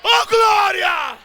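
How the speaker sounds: slow, stretched, loud cry of glory; shouted praise. Real, not acted. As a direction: call it out to the heavens. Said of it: "exclamação de louvor". (glória gritada e arrastada)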